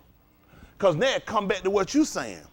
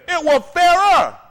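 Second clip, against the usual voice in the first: slow, louder, higher declaration; forceful; 9 semitones higher, 7 dB louder, slower than usual. Real, not acted. Lecturing students on the Constitution, loud and slow. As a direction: hammer the point home. Preaching to students, rising heat.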